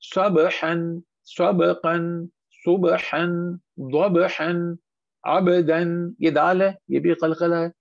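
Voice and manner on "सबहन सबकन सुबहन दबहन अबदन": a short echo after each b, as in "saba-han" for सबहन; qalqalah bounce. Shown as a demonstration. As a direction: bounce every b in सबहन, सबकन, सुबहन, दबहन, अबदन. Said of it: "सारे बा इसी तरह हिलेंगे".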